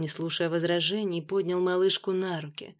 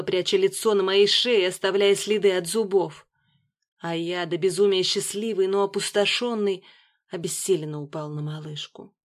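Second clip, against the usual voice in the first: ordinary delivery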